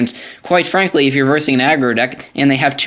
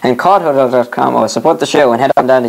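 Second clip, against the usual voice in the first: brisk, louder sponsor announcement; ad read; 4 dB louder than usual. Acted. Reads the show sponsors.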